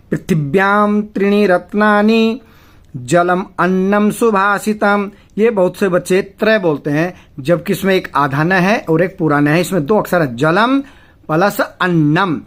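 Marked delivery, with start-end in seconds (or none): 0.1-5.1 slow, measured Sanskrit chant, slightly raised; shloka recitation. Shown as a demonstration. Teacher reciting the verse to his class, measured chant. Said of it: "ध्यान दीजिएगा". 11.6-12.4 अन्नम with a doubled, held न्न; clear double n. Shown as a demonstration. Teacher showing students the doubled n in Annam. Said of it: "आधा न और न".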